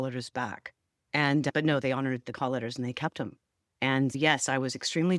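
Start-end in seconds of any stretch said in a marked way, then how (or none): none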